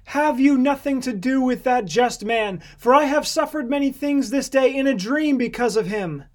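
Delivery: high, earnest entreaty; wife's plea. A narrator's reading. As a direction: voice the wife urgently warning her husband. Wife's urgent plea to Pilate.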